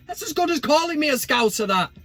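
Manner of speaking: high squeaky indignation; high-pitched voice. Acted. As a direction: squeak it out in comic outrage. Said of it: "in that high-pitched voice".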